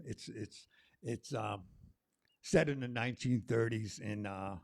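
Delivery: ordinary delivery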